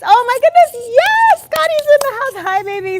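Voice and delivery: high, loud delighted squeal; excited squeal. Real, not acted. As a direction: squeal with joy at a friend arriving. Greets a favorite viewer arriving.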